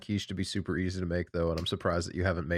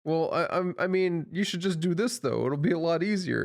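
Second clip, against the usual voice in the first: higher, self-mocking nagging; mock know-it-all; 8 semitones higher than usual. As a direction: imitate yourself giving unwanted advice. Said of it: "I become the guy that I hate".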